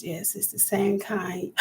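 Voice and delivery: ordinary delivery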